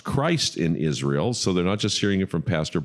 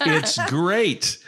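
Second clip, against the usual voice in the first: high, bright-faced fake cheer, voice pitched up; forced cheer; 9 semitones higher than usual. Pastor in the cold Jordan answering the group, mock cheerful. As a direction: pretend the freezing water is fine.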